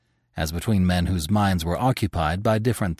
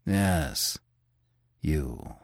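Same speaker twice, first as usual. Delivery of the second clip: quiet, calm; 5 semitones lower, 8 dB quieter than usual. Acted. Manfred answers calmly.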